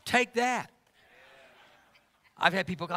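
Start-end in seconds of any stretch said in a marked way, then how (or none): 0.0-0.7 playful taunt. Real, not acted. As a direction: toss it out like a cheeky dare. Playful boast to congregation.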